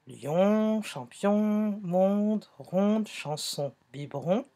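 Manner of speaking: slow list, holding each nasal on; stretched target sound. Shown as a demonstration. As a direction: draw out the on in lion, champion, monde, ronde, chanson, biberon. Stretching the shared sound for pupils. (liste lente en allongeant le son on)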